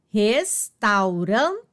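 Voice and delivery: restaurante drawn out slowly, syllable by syllable. Shown as a demonstration. Teacher to pupils: restaurante stretched out slowly, syllable by syllable. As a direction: spell out restaurante slowly for the class. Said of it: "teacher repeats word slowly".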